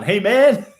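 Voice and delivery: "man" high and rising through a laugh; laughing hey man. Shown as a demonstration. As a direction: giggle it out, up on "man". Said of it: "Or hey, man?".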